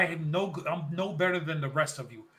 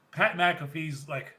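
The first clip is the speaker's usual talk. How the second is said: usual speaking voice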